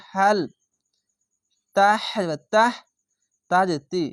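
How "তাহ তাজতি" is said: ordinary talk, nothing marked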